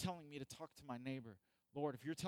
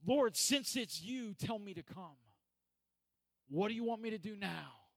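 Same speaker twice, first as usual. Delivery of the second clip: raised, emphatic preacher's appeal; fervent plea; 5 semitones higher, 7 dB louder than usual. Acted. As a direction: call out to the Lord, eager for orders. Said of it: "Why do we not then say".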